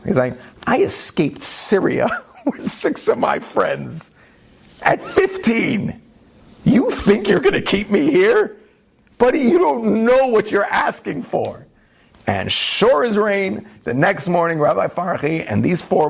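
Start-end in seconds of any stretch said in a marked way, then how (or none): 0.7-11.6 rising cocky challenge, pitched higher; a teen mocking the camp official's threat, cocky and defiant; defiant boast. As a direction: throw it back at him, daring.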